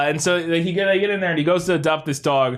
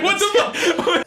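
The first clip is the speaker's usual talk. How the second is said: laughing disbelief; slower than usual. Retold or imitated. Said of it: "I was like".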